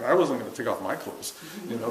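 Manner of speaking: usual speaking voice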